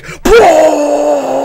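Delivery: high, loud imitated metal yell; hammed-up imitation of a screaming rocker; mock metal shout. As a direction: belt it like a metal singer's opening shout. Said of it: "some guy's just going".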